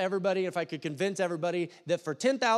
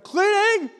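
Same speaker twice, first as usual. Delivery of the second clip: much higher, much louder startled cry; panicked blurt; 11 dB louder than usual. Retold or imitated. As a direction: shout the lie before you think. Kid caught idle blurts an excuse.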